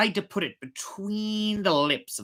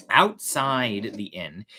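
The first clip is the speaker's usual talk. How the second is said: ordinary delivery, nothing marked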